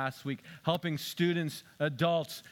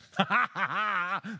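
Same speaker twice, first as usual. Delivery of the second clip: short real laugh at his own joke; self-mocking laugh; 4 semitones higher than usual. Real, not acted. Pastor laughing at his own joke, short chuckle. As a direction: chuckle at the silly boast.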